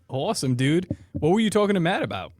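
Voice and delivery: usual speaking voice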